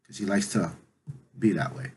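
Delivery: usual speaking voice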